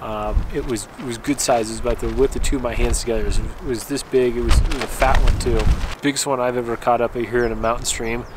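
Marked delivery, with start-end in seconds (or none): none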